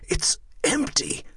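slow, high, airy disbelief; stunned cry without volume; shocked exclamation. Acted. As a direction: gasp it out, leaping high on the key word. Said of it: "the young friar exclaimed".

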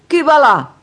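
high, loud "who goes there" shout; shouted challenge. A narrator's reading. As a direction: call out sharply into the dark at an intruder. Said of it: "urlò". (grido acuto di allarme)

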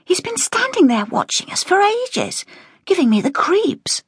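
uneasy hushed warning; nervous young woman's whisper. A narrator's reading.

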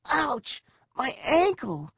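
high-pitched cry of pain; pained groan. A narrator's reading. Eric crawling on hurt ankle, high pained groan. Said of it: "he groaned".

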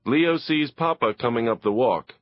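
ordinary delivery, nothing marked

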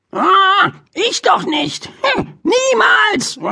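very high, slow squeaky bird voice; high squeaky magpie feigning innocence; innocent magpie. Acted. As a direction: play the cheeky magpie pretending innocence. Magpie Pinky answers innocently.

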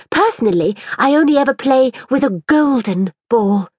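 lightly voiced haughty girl. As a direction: look down your nose at the toy. Mirabel's haughty voice.

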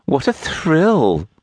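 slow, knowing, a touch high; arch. A narrator's reading. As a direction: tease him coyly. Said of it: "almost archly".

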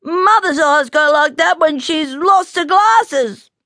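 high, plaintive cub making excuses; tearfully brave little bear; putting on bravery. A narrator's reading. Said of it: "he said bravely".

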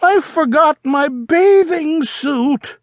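high, whiny confession; worried Froggy. Acted. Froggy confessing to Dad again, high whiny voice. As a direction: wail the bad news.

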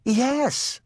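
high little-sister voice; timid little sister. A narrator's reading. Baby admits it to the firefighter, high and timid.

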